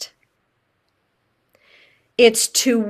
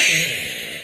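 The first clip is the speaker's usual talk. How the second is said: laugh; 13 semitones lower, 7 dB quieter than usual. Real, not acted. Laughing at own outburst.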